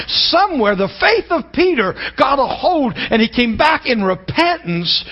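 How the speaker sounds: high, loud preaching with shouted peaks; full-force preaching. Acted. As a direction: preach it hard to the congregation, slowing on key lines. When I really get riled up.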